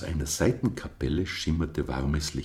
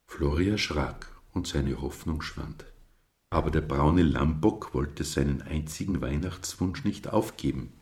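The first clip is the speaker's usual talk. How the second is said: usual speaking voice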